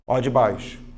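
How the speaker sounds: ordinary talk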